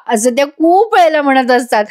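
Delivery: ordinary delivery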